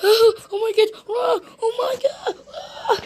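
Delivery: high sobbing cries, fading off; crying wail. A narrator's reading. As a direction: wail like a little girl bursting into tears. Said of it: "burst into tears".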